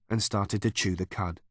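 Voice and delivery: plain reading voice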